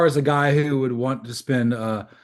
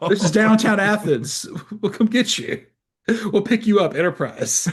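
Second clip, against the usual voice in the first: high ad voice; 8 semitones higher than usual. Retold or imitated. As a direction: sell it like a cheesy ad. Voicing Enterprise rental ad.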